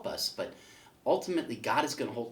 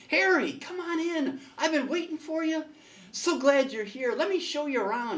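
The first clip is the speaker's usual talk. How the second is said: God welcoming Harry; 6 dB louder than usual. Acted. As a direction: greet him like a jolly host in heaven, pitched way up. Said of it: "He says".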